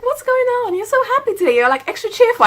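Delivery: teasing colleague asking why so happy; high coworker voice. Retold or imitated. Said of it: "she was like".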